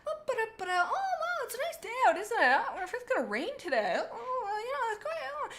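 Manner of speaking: very high, airy, innocent sing-song; oblivious chatter. Retold or imitated. Darktail pretends not to notice the fight, in high airy sing-song. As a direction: play dumb and look away, breezily.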